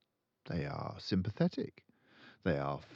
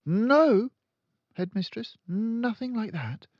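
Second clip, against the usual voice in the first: Miss Honey replying; 13 semitones higher, 6 dB louder than usual. A narrator's reading. Timid teacher answers Trunchbull.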